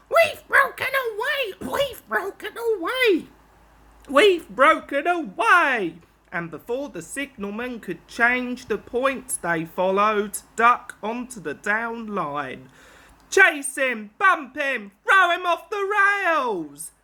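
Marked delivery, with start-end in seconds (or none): 0.1-6.0 gleeful trucks. Acted. Runaway trucks chanting. 13.3-16.9 loud, higher mob yell. Acted. Trucks yell as they chase Duck, loud and higher. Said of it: "they yelled".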